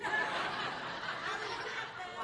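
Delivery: breathless silent laugh; real laugh. Real, not acted. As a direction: laugh under the breath at the joke. Reacts to own joke.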